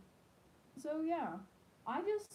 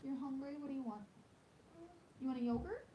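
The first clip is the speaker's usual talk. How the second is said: gentle mom; 5 semitones higher, 5 dB quieter, faster than usual. Real, not acted. Mother asks child about snack.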